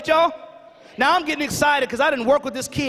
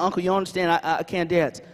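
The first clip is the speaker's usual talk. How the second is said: put-on dejected little boy; 6 semitones lower, 4 dB quieter than usual. Nephew insists he cannot dance.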